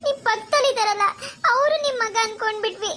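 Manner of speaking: ordinary delivery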